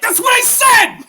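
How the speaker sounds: high, loud comic outburst; exasperated shout. Acted. Tenant to teasing landlord, exasperated high shout. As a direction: explode in drawn-out mock exasperation.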